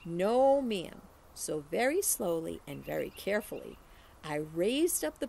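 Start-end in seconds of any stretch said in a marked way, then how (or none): none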